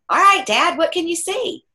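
bright, high, coaxing question to a father; coaxing gently. Retold or imitated. Son gently asking his dying father what he sees, high and coaxing. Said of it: "And I'm like".

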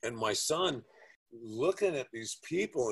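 normal talking voice

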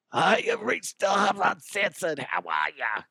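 put-on ten-packs-a-day rasp; strained smoker's voice. Host imagining his next episode after straining his voice, mock smoker's rasp. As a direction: pretend your voice is wrecked by smoking. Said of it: "I'm gonna sound like I smoke ten packs a day".

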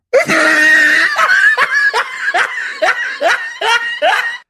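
big, high, shrieking laugh; loud laugh after teasing his own humor; loud laugh burst. Real, not acted. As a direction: explode into laughter.

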